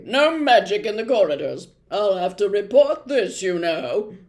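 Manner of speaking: loud pompous prefect warning; loud officious reprimand. A narrator's reading. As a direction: lay down the rule loudly. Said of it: "said Percy loudly".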